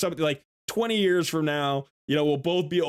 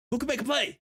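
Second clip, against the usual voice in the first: fan call; 9 semitones higher than usual. Pretend. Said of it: "Hey, T O".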